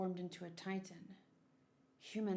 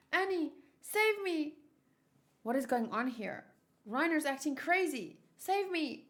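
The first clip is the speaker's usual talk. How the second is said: higher, panicked shouts for help; cry for help; 7 semitones higher, 6 dB louder than usual. Acted. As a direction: scream to Annie for rescue. Marco calls for help.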